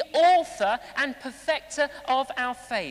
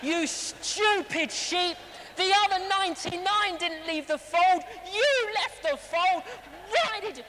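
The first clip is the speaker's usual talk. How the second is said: mock scolding; 5 semitones higher than usual. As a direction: scold a stray sheep, building higher. Acted scolding of the found sheep.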